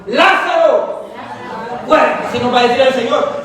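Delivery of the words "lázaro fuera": a ringing, stretched-out call, punched at the end; loud command. Shown as a demonstration. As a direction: shout it to summon the dead. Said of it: "Dígalo fuerte, hermano".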